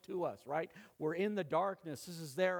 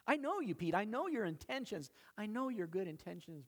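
higher, earnest voice of Jesus speaking to Peter; 7 semitones higher than usual. Acted. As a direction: speak as Jesus, knowing and kind.

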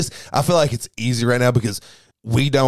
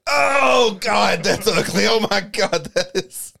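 shrieking, high-pitched disgust after spit take; spray the drink and shriek; 11 semitones higher, slower than usual. Acted. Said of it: "so I can do a spit take".

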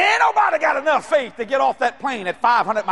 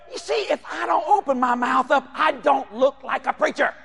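usual speaking voice

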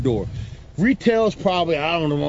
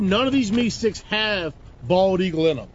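ordinary talk, nothing marked

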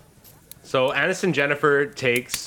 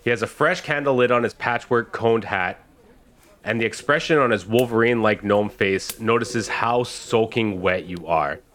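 normal talking voice

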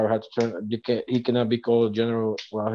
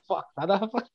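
high, hushed mock exasperation; mock-indignant, pitched well up; upset disbelief; 8 semitones higher, 5 dB quieter than usual. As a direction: imitate upset personnel in a high, softer voice. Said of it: "Navy personnel upset".

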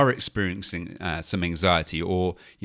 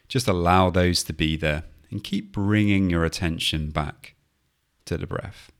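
guided meditation. Acted. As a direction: gently talk them through the breath. Leads a mindfulness body scan.

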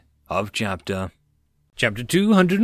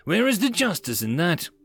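high, emphatic challenge; fervent; 7 semitones higher than usual. A narrator's reading. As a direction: demand it with some fervour. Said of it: "the speaker fervently said".

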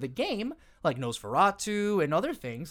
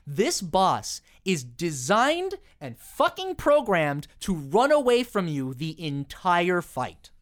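ordinary talk

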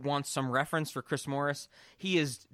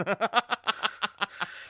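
higher, loose giggle; real laugh; 5 semitones higher than usual. Real, not acted. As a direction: laugh off the awkward question. Laughing at his own question.

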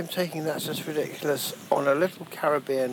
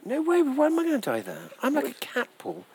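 high, bright boasting protest; excited; 12 semitones higher than usual. Real, not acted. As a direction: scoff at the danger, pitch up high. Boasting he cannot die.

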